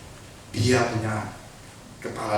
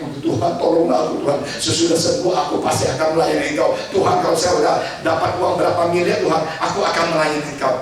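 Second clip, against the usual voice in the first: mock desperate prayer; 5 semitones higher, 6 dB louder, faster than usual. Mocking desperate bargaining prayer.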